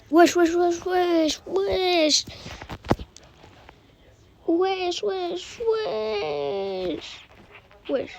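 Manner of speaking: high drawn-out wesh chant; playful high calls. Pretend. Playful repeated calling while playing.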